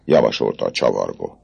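usual speaking voice

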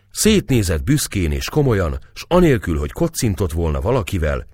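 ordinary delivery

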